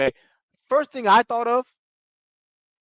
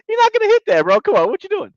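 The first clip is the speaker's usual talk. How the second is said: laughing mock scold; 13 semitones higher, 5 dB louder, faster than usual. Real, not acted. Mocking Patrick's missed shot.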